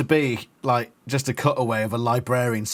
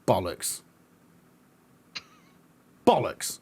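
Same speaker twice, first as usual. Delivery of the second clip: British swear; 6 semitones lower, 3 dB quieter than usual. Acted. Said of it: "any accent you've ever done".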